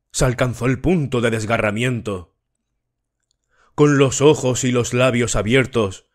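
plain reading voice

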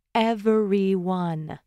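EVERYONE drawn out very slowly; slow, deliberate stress on "Everyone"; pointed emphasis. A narrator's reading. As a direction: stretch every syllable of Everyone. Mom firmly repeats her rule.

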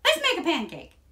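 high, loud eager cry; excited shout. A narrator's reading. Jack urges his mother to start cooking, shouting. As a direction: call it out like an eager boy. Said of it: "shouted Jack".

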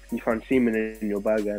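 normal talking voice

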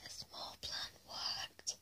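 very soft, slow whisper; hushed secret gloating; secret thought. Acted. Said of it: "They said it in their minds".

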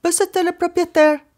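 high, slow shout through a closed door. A narrator's reading. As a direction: call out with all her strength, slowly. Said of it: "juntó todas sus fuerzas y gritó". (grito agudo y lento a través de la puerta)

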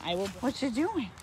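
ordinary delivery

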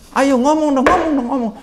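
high, loud rallying cry; egging the crowd on, loud and high; urging call. Acted. Urging silent academics to speak.

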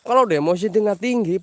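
ordinary talk, nothing marked

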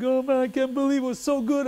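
mock weeping with happiness; imitating a crying friend. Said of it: "he was crying like a baby".

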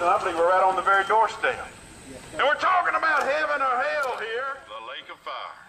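usual speaking voice

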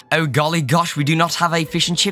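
hammed-up British accent, chatty host; mock British accent. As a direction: put on an over-the-top British accent. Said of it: "I can't do this accent".

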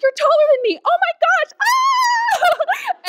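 shrieking with joy at meeting him; crazy excited. Retold or imitated. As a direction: squeal it like you're jumping up and down. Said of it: "I get crazy excited about stuff".

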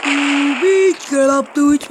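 taunt. Acted. Taunting a downed opponent.

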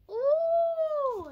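high, loud delighted ooh; a real burst of excitement; excited ooh. Real, not acted. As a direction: gasp of delight at the reveal. Opening reveal of a popsicle.

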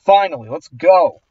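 mild triumphant yell; celebrating with a held-back shout; retold relieved yell. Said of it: "I yelled".

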